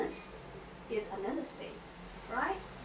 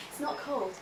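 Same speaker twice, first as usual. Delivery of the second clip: laughing; 5 semitones lower, 3 dB louder than usual. Real, not acted. Laughs about the pool.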